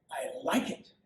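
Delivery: first one nearly whispered, breathy and soft; hushed approval. Acted. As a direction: breathe it, almost to yourself. King repeats his approval.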